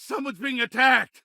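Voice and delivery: high, frantic scream for help; panicked cry for help. Acted. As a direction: yell it like you're watching an assault. Bystander screams during street attack.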